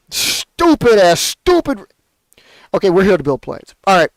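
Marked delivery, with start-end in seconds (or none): 0.1-1.8 exasperated insult. Real, not acted. Insulting whoever caused the lockdown.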